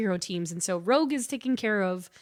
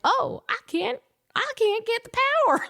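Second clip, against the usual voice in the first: high, fast, squeaky Rogue voice; mock whiny Rogue; 14 semitones higher than usual. Host voices Rogue failing to drain Wonder Man, high and fast. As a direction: play Rogue as a flustered girl.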